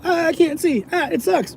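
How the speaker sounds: high-pitched mock whine; exaggerated high, pained complaining; mock distressed wail. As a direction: wail it high like a helpless victim. Imitates man with stinging eyes.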